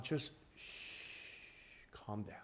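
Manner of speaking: gentle whispered calming; hushing whisper. Acted. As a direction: hush them like a child. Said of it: "hushing the congregation".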